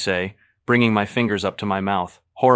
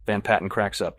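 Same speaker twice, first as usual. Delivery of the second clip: ordinary delivery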